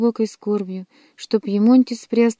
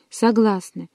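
ordinary delivery

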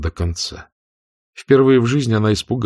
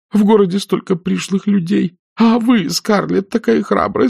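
put-on high, fluttery frightened old aunt; a timid spinster begging for protection; fearful pleading; 11 semitones higher than usual. A narrator's reading. Aunt Pittypat begs Scarlett to stay, high and anxious.

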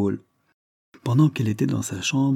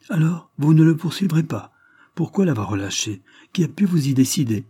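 asking sharply; 6 semitones higher, 3 dB louder than usual. A narrator's reading. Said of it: "demanda vivement monsieur Lester".

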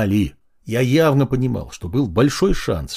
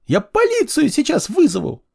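hysterical woman. Acted.

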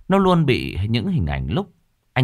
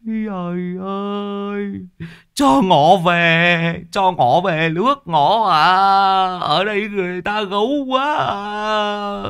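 very slow, much higher tearful wail; drawn-out crying plea, louder in the middle; whimpering wail; 12 semitones higher, 4 dB louder, much slower than usual. Acted. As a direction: blubber a plea to be sent home. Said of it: "lão mếu máo".

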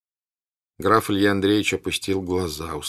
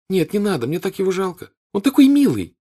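put-on pitying girl; 12 semitones higher, 7 dB louder than usual. A narrator's reading. Natasha pitying him.

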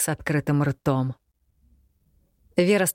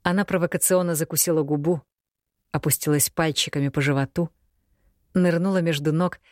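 ordinary delivery, nothing marked

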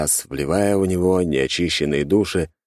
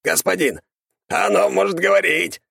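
high, gleeful skeleton voice; laughing skeleton; 14 semitones higher than usual. A narrator's reading. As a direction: cackle it like an eager servant. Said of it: "засмеялся Герхард".

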